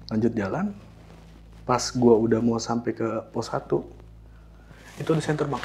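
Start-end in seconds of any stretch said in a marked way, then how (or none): none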